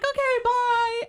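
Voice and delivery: very high, slow singsong; mock cutesy goodbye. Imitating the singer sending a fan off, high mock cutesy. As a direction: draw it out in a squeaky sing-out.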